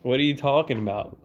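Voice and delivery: ordinary talk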